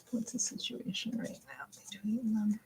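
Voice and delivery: hushed whispered question; whispering. Real, not acted. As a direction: whisper it to the cards.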